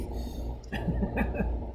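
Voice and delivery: airy wheezing laugh; breathy silent laugh. Real, not acted. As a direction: laugh without voice. Laughing at his story.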